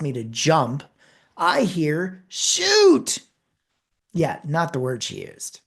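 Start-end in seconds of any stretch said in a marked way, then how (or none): 2.3-3.2 retold outburst, sharp and high; sudden loud curse. Frustrated mother cursing in the checkout aisle, loud and high. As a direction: blurt it out so people jump. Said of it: "extremely loudly".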